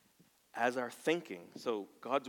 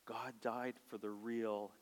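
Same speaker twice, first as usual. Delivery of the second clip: ordinary delivery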